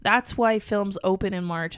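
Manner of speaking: ordinary talk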